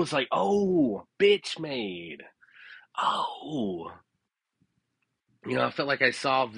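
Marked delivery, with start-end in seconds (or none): 0.3-3.9 high, drawn-out oh of sudden understanding; the penny finally drops; dawning realization. Retold or imitated. Finally understanding the woman's phrase, high dawning realization.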